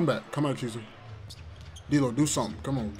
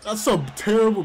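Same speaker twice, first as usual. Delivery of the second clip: annoyed outcry; 7 semitones higher, 5 dB louder than usual. Real, not acted. Complaining about refs.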